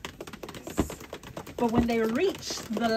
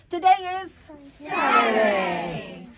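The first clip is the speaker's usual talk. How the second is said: louder, slow, stretched refrain; mother proclaiming the refrain; triumphant Saturday; 6 dB louder, much slower than usual. A narrator's reading. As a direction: hold out the last word big. Refrain shouted slowly.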